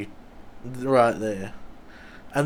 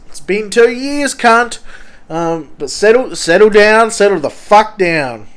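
higher, louder heated outburst; heated shout; 8 semitones higher, 10 dB louder than usual. Retold or imitated. Old enemy brought up the bike again, heated shout. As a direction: blow up at him, then tell him to calm down.

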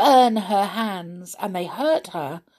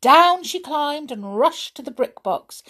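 usual speaking voice

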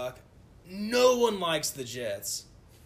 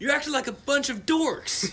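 scolding teammates; 10 semitones higher, 8 dB louder than usual. Retold or imitated. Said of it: "And Phillip Rivers is like".

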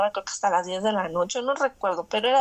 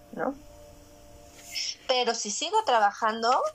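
ordinary delivery

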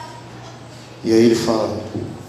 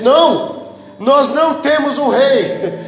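high, drawn-out defiant cry; acted crowd refusal; 13 semitones higher, much slower than usual. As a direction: refuse it as the crowd, pitch soaring. Crowd rejects Jesus as king.

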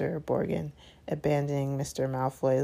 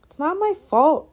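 high, loud protest; indignant retort; 12 semitones higher, 9 dB louder than usual. A narrator's reading. Son retorts to his father, high and indignant. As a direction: shoot back defensively in a much higher voice. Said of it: "retorted Draco".

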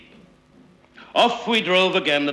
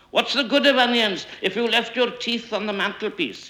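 querulous question; 9 semitones higher than usual. A narrator's reading. Member heckles over the onions.

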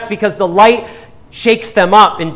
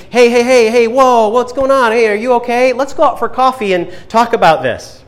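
lightly acted, higher-pitched friendly intervention; mock concerned friend; 5 semitones higher than usual. Preacher voicing how Jesus would not handle a demon, mock concerned. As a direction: play an over-gentle peacemaker, pitched up.

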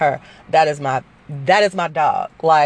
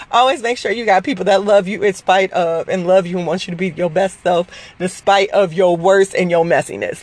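ordinary talk, nothing marked

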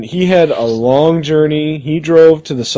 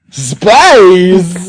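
dramatic shout; 3 semitones higher, 5 dB louder than usual. Acted. Dramatic announcement.

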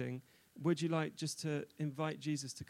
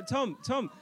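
raised voice calling someone up to the stage; cheerful call to a guest to come forward; 7 semitones higher, 3 dB louder, slower than usual. Real, not acted. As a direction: call him up from across the room. Calling Tom up to the front.

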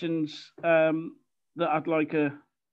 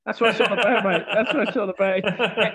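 laughing; 3 semitones higher, 4 dB louder than usual. Real, not acted. Laughing reply to the co-host.

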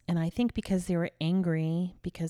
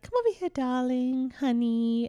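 much higher, cooing "darling, honey"; sweet English mum; 6 semitones higher than usual. Retold or imitated. English mum calling her child over, much higher and sweet. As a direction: coo it much higher like a doting mother. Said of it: "They're like".

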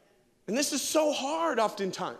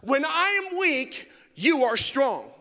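heartfelt prayer; 3 semitones higher, 3 dB louder than usual. Acted. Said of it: "in this place of saying".

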